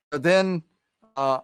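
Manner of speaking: ordinary delivery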